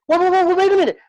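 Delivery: urgent, loud and pleading; urgent loud plea. Retold or imitated. Mentor recalling the student's line, urgent loud plea. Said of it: "You were even louder".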